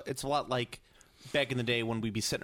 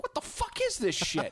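much higher, slow, baffled squeal; mock disbelief. Recalling seeing fan art, in high mock disbelief. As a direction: act out the outrage of a confused browser. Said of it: "I'm like".

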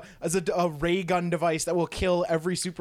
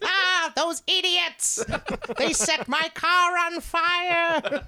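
high, slow exasperated cartoon villain; 11 semitones higher, much slower than usual. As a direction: whine like a thwarted supervillain. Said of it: "it is the de facto villain voice".

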